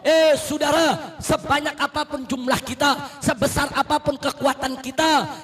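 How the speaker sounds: loud, high preaching building to a shout; fiery sermon. Real, not acted. Preacher rousing the congregation, loud and rising. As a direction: preach with full fire to the crowd.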